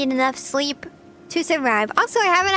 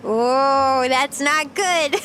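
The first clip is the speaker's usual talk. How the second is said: ordinary delivery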